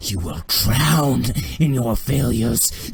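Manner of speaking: slow, loud menace; wrathful condemnation. Acted. Thrawn to the man choking on blood, slow wrathful condemnation. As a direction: pronounce it slowly as a furious death sentence.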